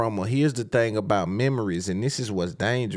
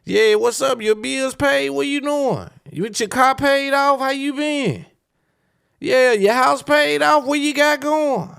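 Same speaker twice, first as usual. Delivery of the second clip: high, loud, eager sweet talk; high showy concern; smooth caller checking in; 4 dB louder than usual. Retold or imitated. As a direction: play the ex swooping back in, much higher. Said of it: "like you just been tapped in".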